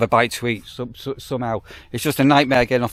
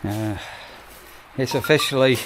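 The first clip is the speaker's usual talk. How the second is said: ordinary delivery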